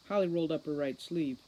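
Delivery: ordinary delivery, nothing marked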